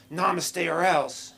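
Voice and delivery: high, drawn-out zen threat; pushy therapist. Retold or imitated. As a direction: order calm like a menacing meditation teacher. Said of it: "be more zen instead of going".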